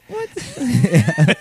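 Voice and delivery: high put-on girl "Yeah"; a quick hammy girl voice; man doing a woman's voice. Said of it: "default girl impression".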